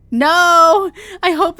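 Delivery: sharp loud cry; loud pleading no; desperate no. Acted. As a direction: shout it out in panic. Hoping she isn't too late.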